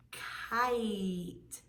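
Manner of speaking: "Kite" with a long, stretched I; drawn-out vowel. Shown as a demonstration. Teacher asking children to hear the I sound, drawn-out "Kite". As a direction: hold the I sound in "Kite" slowly. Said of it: "Do you hear the I sound".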